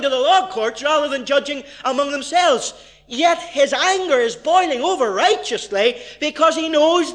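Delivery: rising sermon intensity; fiery preaching. Acted. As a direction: preach it with righteous fire. Preaching at full force.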